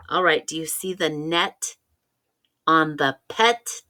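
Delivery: ordinary delivery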